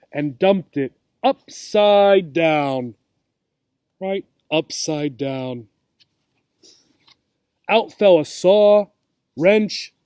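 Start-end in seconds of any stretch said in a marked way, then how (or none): 4.5-5.7 slow, soft and low repeat; emphasized repeat. Shown as a demonstration. Reading to children, repeating a key phrase slowly. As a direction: let the words sink in for the kids. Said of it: "reader repeats for children".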